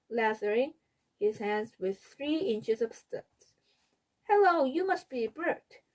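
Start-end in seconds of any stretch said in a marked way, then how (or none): 4.3-5.8 high, chirpy puppet voice; Sesame Street voice. A narrator's reading. Fudge greeting Peter in his Sesame Street puppet voice. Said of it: "in his Sesame Street voice".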